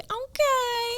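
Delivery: high, soft, drawn-out okay. Shown as a demonstration. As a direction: agree with a coy stretched okay.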